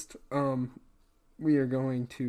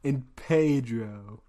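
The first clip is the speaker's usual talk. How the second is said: deliberately wrong name; 4 dB louder, much slower than usual. Shown as a demonstration. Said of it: "deliberately saying the wrong name".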